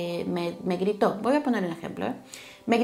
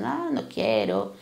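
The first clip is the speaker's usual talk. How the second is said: much lower, slow put-on hard voice; mock tough refusal; 10 semitones lower, slower than usual. Reader imitates a guarded person brushing others off, low and slow. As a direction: lightly play someone hiding behind a hard mask. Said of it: "las máscaras ... de dureza".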